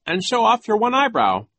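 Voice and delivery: high, loud playful announcement; pretend joking. A narrator's reading. Violet reaches for his turban, loudly pretending to joke. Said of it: "as if she were still joking".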